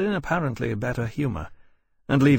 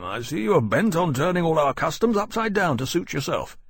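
higher-pitched, disapproving commander; brusque senior officer; 6 semitones higher than usual. A narrator's reading. Commander inspecting Lawrence's bandboxes, critical and brusque. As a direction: needle the newcomer with rank behind you.

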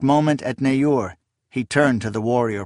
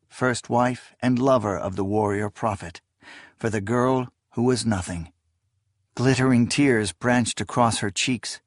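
plain reading voice

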